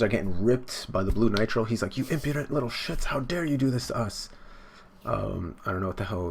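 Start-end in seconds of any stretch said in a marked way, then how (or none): 2.0-4.3 high, mock-outraged rant; hammed-up villain outrage; acted indignation. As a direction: scold them in pitched-up mock fury. Acting out outraged character scolding enemies.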